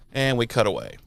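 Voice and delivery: normal talking voice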